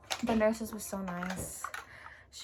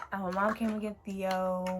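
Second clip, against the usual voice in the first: normal talking voice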